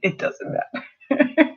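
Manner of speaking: breathy laugh through the words, slow. Real, not acted. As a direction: let the laugh take over the line. Laughs at the silly movie.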